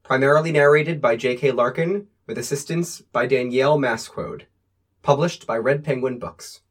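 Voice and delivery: usual speaking voice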